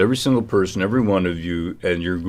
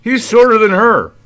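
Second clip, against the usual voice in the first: mock accent voice; 6 semitones higher, 4 dB louder than usual. Mocking impression continues.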